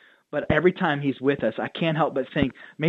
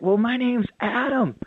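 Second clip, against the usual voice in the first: much higher, bright eager hello; excited greeting; 9 semitones higher than usual. Retold or imitated. Pastor retelling how he greeted an eager churchgoer, excited and high. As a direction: greet him like you're thrilled to meet him.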